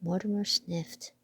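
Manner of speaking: usual speaking voice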